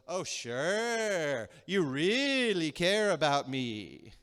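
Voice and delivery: slow, drawn-out sarcastic echo; fed-up mockery. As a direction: stretch the words to mock your husband. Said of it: "the wife kinda fed up goes".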